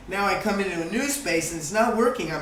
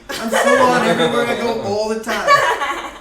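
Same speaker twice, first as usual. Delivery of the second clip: louder, laughing, all-in; full-on energy; 5 semitones higher, 8 dB louder than usual. Real, not acted. Speaker showing how full-on she lives, loud and laughing. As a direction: blast it out with total intensity.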